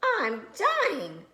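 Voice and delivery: high dramatic wail; Baby Bear crying. A narrator's reading. Baby Bear after hot porridge, high hammed-up wail. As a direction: ham up a tiny bear's despair. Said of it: "cried Baby Bear".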